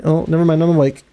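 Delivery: normal talking voice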